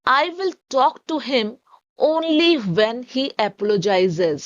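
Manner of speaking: each English word said very slowly and clearly; slow dictation reading. Shown as a demonstration. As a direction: read it out slowly for students to copy. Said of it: "हमारा sentence English में होगा".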